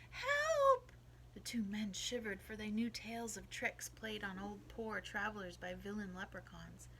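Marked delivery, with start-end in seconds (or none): 0.1-0.9 high, very loud shout; cry for help. A narrator's reading. Unseen voice calling through the darkness, crying out for help. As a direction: shout for help at full pitch. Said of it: "through the darkness came a cry".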